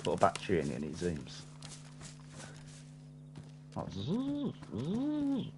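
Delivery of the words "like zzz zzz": high buzzing scope-zoom noise; mechanical zoom sound. Pretend. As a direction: buzz like a zooming lens. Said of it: "I was aiming for a mechanical zoom".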